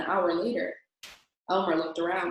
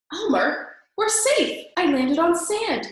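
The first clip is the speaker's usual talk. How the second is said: much higher and louder, relieved shout; dragon yelling; 9 semitones higher, 5 dB louder, slower than usual. A narrator's reading. Dragon to Elmer after landing, yelling with relief. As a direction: shout the good news over the storm. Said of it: "yelled the dragon".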